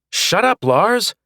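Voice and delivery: high, loud snap; yelling shut up. Acted. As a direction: bark the order at him. Snapping at the robot again.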